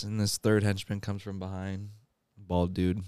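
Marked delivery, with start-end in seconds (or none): none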